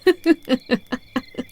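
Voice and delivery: rippling, amused laugh; villainous chuckle. Acted. Captor amused the listener tries to resist, mocking chuckle. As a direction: laugh at their futile resistance.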